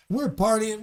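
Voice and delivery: ordinary talk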